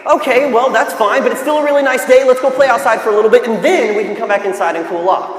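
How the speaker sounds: acted cheery persuasion, fast and lifted; upbeat reply. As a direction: brightly talk a grumbler round.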